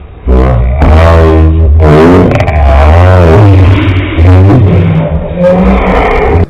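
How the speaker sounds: a long, loud, helpless laugh fading out. Real, not acted. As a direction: laugh hard until it runs out. Long laugh after failed bit.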